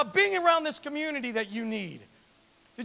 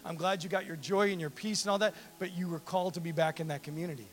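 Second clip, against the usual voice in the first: gentle Jesus; 7 semitones lower, 4 dB quieter than usual. Retold or imitated. Voicing Jesus gently urging.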